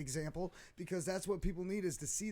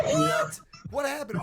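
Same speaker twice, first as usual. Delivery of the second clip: shocked; 8 semitones higher, 8 dB louder, slower than usual. Retold or imitated. Retelling shock at the wait time.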